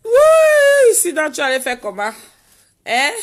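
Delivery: very high, loud mock alarm; hammed-up shock for fun; playful loud teasing to a viewer; teasing exclamation. Real, not acted. Teasing a viewer about bite.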